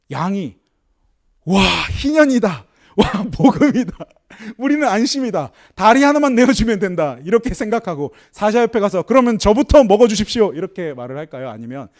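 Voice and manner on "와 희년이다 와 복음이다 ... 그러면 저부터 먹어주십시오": sheep celebrating a gospel that still eats them; joyful sheep. Retold or imitated. Sheep to the lion, loud joyful cheering.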